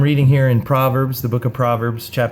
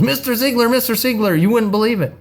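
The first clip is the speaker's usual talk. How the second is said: much higher, eager woman's voice; excited woman; 10 semitones higher than usual. Retold or imitated. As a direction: gush at him, pitched way up. Excited woman greets speaker.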